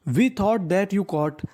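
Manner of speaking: very slow, words spaced apart; slow sentence reading. Shown as a demonstration. Teacher reading the finished sentence to students, very slow. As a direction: read it out slowly for the class.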